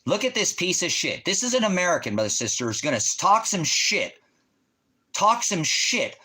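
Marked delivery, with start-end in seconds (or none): 3.2-6.2 very slow, high, sneering repeat; mocking drawl. Real, not acted. Streamer slowly mocking a viewer who talks big. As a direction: stretch it out in scorn.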